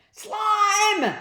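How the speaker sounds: very loud, very high yell; loud shrill-pitched call; shouted. A narrator's reading. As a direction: scream the name up high. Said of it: "the boy shouted again".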